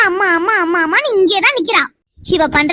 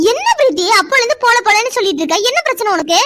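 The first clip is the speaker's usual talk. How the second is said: high, sharp demand; irritated snap; 6 semitones higher than usual. Acted. Mother snapping at a daughter who keeps refusing. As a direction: snap at her, out of patience.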